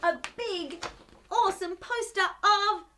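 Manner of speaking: plain reading voice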